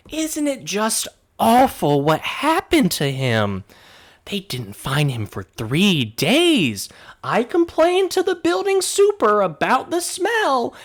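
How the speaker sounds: put-on very high, loud, quavering old lady voice; fussy old neighbour. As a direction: gush over the dead man like a busybody granny. Neighbour complains about the super.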